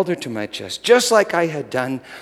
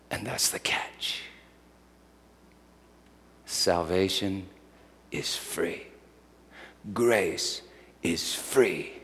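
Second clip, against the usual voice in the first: hushed whisper; 4 semitones lower, 10 dB quieter, slower than usual. Acted. Hushed closing point.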